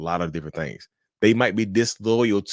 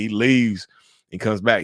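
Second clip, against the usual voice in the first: ordinary talk, nothing marked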